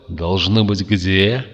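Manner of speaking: ordinary delivery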